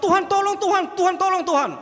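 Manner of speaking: high, loud pleading cry to God; desperate plea. Acted. Acting a desperate begging prayer.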